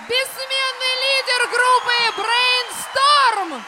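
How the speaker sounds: громко, выше, медленно; чекань титул как в цирке; ringmaster. Real, not acted. Объявление звезды под аплодисменты. (торжественный голос конферансье)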